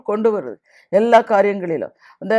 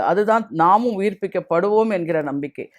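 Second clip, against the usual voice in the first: ordinary delivery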